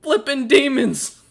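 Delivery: he blasts them for abandoning him; angry accusation. Acted. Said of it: "And he goes".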